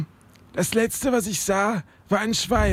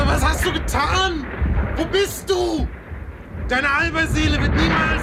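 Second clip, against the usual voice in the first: high, desperate cries; frantic in pain; 9 semitones higher than usual. Acted. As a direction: shout through the pain. In pain after the fall.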